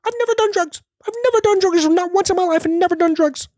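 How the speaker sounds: very high, fast hype-man voice; Gary Vee impression. Mocking a motivational speaker with a very high, fast hype voice. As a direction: ham up a pushy motivational guru. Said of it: "he's clearly on cocaine".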